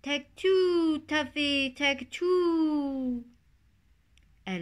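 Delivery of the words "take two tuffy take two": high, loud sing-song bird call; the turtle dove's high, loud, silly call; silly dove cry. A narrator's reading. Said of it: "its silly cry".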